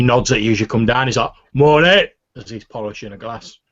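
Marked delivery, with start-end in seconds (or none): 1.5-2.1 loud, higher orc greeting; surly orc barkeep. Acted. Orc barkeep greeting a customer coming down, surly and loud. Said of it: "there's the surly-looking orc".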